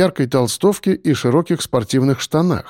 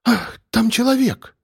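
startled cry; 10 semitones higher than usual. A narrator's reading. Olesya discovers someone is there, startled cry. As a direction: cry out in alarm, slowly. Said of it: "вскрикнула Олеся".

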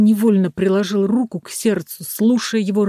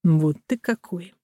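quiet; 5 dB quieter than usual. A narrator's reading. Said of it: "сказал тихо".

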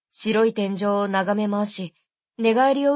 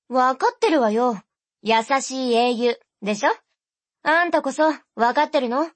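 impatient sister; 2 semitones higher than usual. Acted. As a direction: needle him back.

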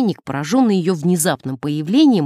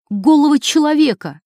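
blurting out the scandal; shocked; 9 semitones higher, 6 dB louder than usual. Acted. Nun blurts out to the priest, shocked and loud. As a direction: voice jumps high and loud on the last words.

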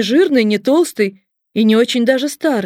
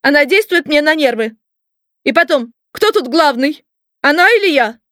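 higher-pitched, rising indignation; indignant boss; 5 semitones higher, 3 dB louder than usual. A narrator's reading. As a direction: demand it like an affronted little boss. Boss rages about who is in charge.

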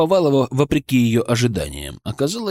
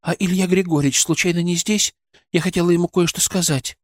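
put-on timid young nurse; 6 semitones higher than usual. A narrator's reading.

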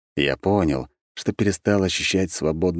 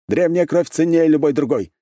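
sharp defiant retort, high and loud; 10 semitones higher, 5 dB louder than usual. A narrator's reading. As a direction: snap it back proudly. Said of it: "резко вскинул голову".

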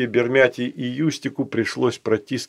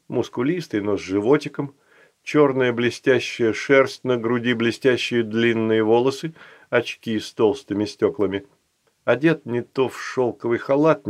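usual speaking voice

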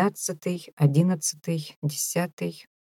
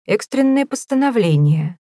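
louder, higher, urgent news; loud announcement; 6 semitones higher, 4 dB louder than usual. Acted. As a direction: announce the emergency loudly. Phipps reporting news.

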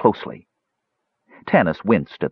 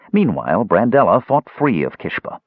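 ordinary delivery, nothing marked

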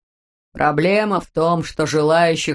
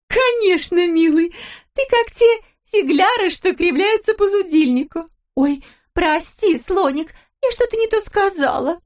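cooing wife; 12 semitones higher than usual. A narrator's reading. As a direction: whine sweetly at the husband. Wife character voice. (высоко, медленно, сюсюкая)

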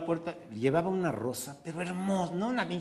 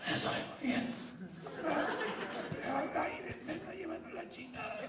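faked dying gasp; 11 semitones higher, 9 dB quieter than usual. Wife faking dying, gasping.

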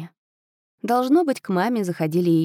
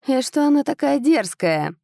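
тянет слова с показной обидой; наигранная обида, выше; протяжно, обиженно; 8 semitones higher, 4 dB louder than usual. Acted. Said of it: "протянул первый, как будто обиженно". (протяжно и обиженно)

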